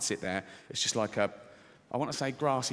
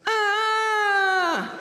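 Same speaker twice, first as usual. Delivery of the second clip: long, high, loud sustained wail; woman's wail; 6 dB louder than usual. Retold or imitated. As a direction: hold a piercing high wail. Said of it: "she goes, like that".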